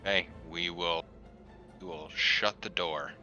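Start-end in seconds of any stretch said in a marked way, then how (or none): none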